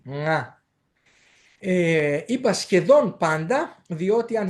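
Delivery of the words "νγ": ΝΓ as a pure nasal ng; entirely through the nose. Shown as a demonstration. Teacher explaining the nasal sound, ng entirely through the nose. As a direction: send ΝΓ all through the nose. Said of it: "πηγαίνει εντελώς από τη μύτη".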